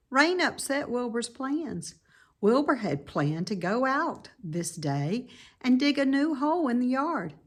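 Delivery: plain reading voice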